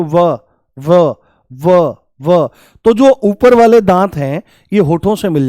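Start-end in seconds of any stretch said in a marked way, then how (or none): 0.0-2.5 "व" repeated as a separate sound, teeth touching lip; isolated "व", upper teeth meeting the lip; sound demo. Shown as a demonstration. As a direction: sound out "व" several times for learners. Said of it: "जैसे बोल दो".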